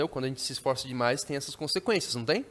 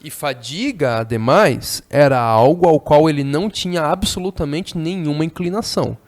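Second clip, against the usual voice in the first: reading a novel aloud; 10 dB louder than usual. Acted. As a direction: declaim the passage to the class.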